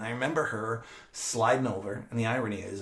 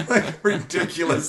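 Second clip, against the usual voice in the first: laughing; 9 semitones higher, 11 dB louder, slower than usual. Real, not acted. Laughing at dangerous toys.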